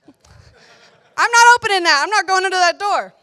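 loud, high-pitched protest; mock refusal. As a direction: refuse loudly, half playing it up. Acting out refusal at a door.

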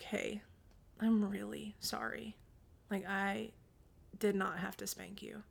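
soft, tender apology, retold; hushed and careful, comforting a child; gentle apology. Mom holding her crying child apologizes, soft and gentle.

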